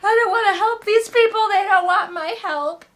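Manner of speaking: over-the-top fake crying princess voice; mock princess crying. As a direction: whine it like a melodramatic princess running off in tears. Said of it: "crying full princess mode".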